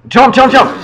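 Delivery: shrieking jump jump; high panicked yell; being chased. Real, not acted. Frantic jump.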